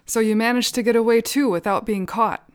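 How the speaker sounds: loud triumphant "Ha!", then gleeful; pleased with himself, loud and bright; exclaiming. A narrator's reading. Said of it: "Kyungha exclaimed".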